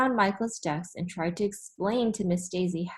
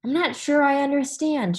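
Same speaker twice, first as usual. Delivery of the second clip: higher, louder, puzzled teacher; 8 semitones higher, 5 dB louder than usual. Acted. Teacher to the class, unsure of the crayons, high and puzzled. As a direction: sound cheerfully baffled.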